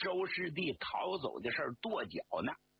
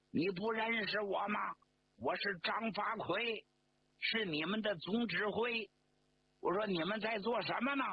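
raised, higher voice without real shouting; calling from afar; 6 semitones higher than usual. A narrator's reading. General calling across to the sentries, raised voice. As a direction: project it as a distant call. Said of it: "远远的高声喊道".

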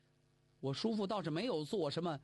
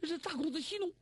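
frightened plea; 8 semitones higher than usual. A narrator's reading. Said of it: "吓得小道童突突突突身子一阵发颤".

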